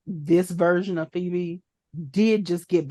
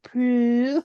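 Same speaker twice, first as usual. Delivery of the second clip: imitating Patty calling; 4 semitones higher than usual. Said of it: "neither one of them say the daughter's name".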